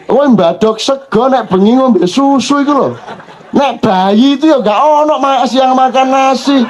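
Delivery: mock the child's protest; a hint of a protesting child; complaining child. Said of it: "Anakku iki ngeluh".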